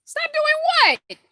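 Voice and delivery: high, loud playful protest; mock outrage. Real, not acted. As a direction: pretend to be offended at the chat, loudly. Chat objects to showing teeth.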